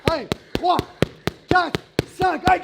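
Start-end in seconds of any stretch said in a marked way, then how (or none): none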